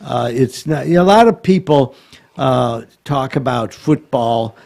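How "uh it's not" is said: ordinary talk, nothing marked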